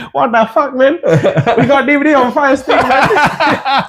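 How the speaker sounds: very high, hammed-up Chinese-accent caricature; comic high-pitched Chinese-accented seller; mock Chinese accent. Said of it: "imagine one Chinese man".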